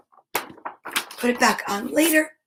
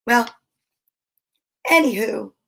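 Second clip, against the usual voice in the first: plain reading voice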